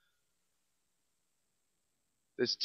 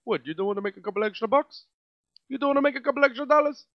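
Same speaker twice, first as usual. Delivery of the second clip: fast, lightly voiced Rastafarian accent; a hasty jokey Rastafarian accent; 5 semitones higher, faster than usual. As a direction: Rastafarian accent, rising and quick. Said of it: "do it in a Ras Zafarian voice".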